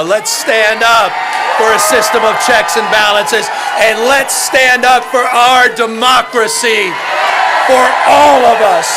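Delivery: loud, high, rousing rally finale; rally speech. Acted. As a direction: build the crowd to a roar.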